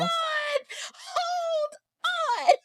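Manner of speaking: high, cracking, half-shouted double take; shocked disbelief. Retold or imitated. Recognizing the celebrity she just grabbed, squealing in shock. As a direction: squeal it like you can't believe who's in front of you.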